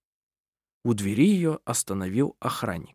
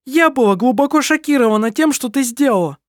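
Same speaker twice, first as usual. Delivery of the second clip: shocked defence; 12 semitones higher, 5 dB louder than usual. A narrator's reading. Lisin defends herself.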